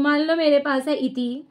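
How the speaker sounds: normal talking voice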